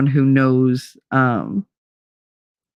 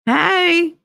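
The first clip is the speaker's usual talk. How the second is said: chirpy greeting; 12 semitones higher than usual. Retold or imitated. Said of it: "he texts me and he's like".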